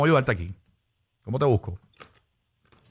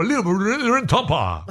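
very slow, higher announcer flourish; hyped station slogan; 6 semitones higher, much slower than usual. Pretend. Host plugging the station to a Tampa caller, hyped drawn-out slogan. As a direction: stretch the slogan out like a radio jingle shout-out.

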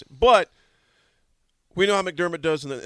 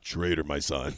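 lower, imitating a godlike boom; voice of God. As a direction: intone it like a deity. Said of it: "That voice was like God".

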